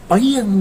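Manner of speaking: a high, sharp crash noise for the mountain impact; mimicking the thunderous slam; crash sound effect. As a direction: burst out the smashing sound. Crash as Arjuna is smashed.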